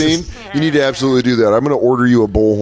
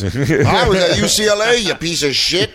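higher-pitched indignant bragging; boastful old-timer; 6 semitones higher than usual. Acted. Cousin Brucey answering the trash talk, indignant boast. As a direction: boast back at the young trash talker. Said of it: "What if he's like".

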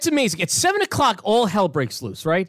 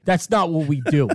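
ordinary talk, nothing marked

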